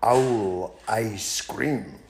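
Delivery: the mouse slowly spelling out the owl's doom; slow punchline. Acted. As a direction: land the threat slowly for effect.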